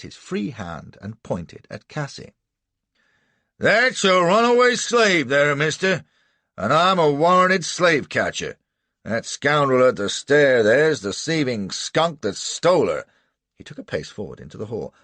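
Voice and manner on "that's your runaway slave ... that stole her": loud, high rough voice; brash slave catcher; swaggering slave catcher. A narrator's reading. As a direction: bark it out like a bounty man. Slave catcher confronting the house.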